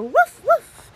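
high, loud woofs; playful dog bark. Pretend. Doggy song chorus, high playful barking. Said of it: "Give me a woof".

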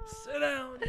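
high squeal through laughter; weak with real laughter; laughing. Real, not acted. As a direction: squeak it out while cracking up. Laughing at being teased.

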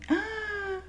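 a sudden high, soft squeal of delight; a breathy little squeal as she sees her own photo. Real, not acted. To listeners about her selfie, a high delighted squeal.

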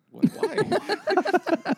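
high, loud disbelieving why; incredulous why. Retold or imitated. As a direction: react in total disbelief. Incredulous retold reaction.

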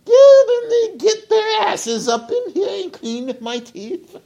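mock Queen screeching for her teeth cleaned; mock royal yell. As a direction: shriek orders at the servants. Queen yelling at the peasants.

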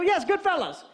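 high, triumphant yes; excited confirmation. Retold or imitated. Caller finally gets the film title, excited confirmation. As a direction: jump on the title, pitch up.

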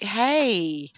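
mock-helpful shop-assistant voice; customer service voice. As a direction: put on the polite shop voice to confront her. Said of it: "I'm like".

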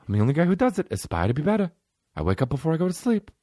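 lightly voiced fitness star pep talk; a hint of a self-serious celebrity; Mark Wahlberg impression. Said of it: "Hey, Mark Wahlberg".

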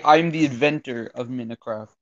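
mock heroic announcement; grand claim. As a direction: proclaim it with mock gravity. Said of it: "I raise my bow and say".

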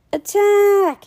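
high, loud charge; battle cry. Acted. Boy attacking the mascot, battle cry. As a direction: yell it as you charge.

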